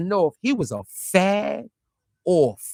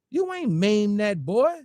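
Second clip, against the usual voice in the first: ordinary talk, nothing marked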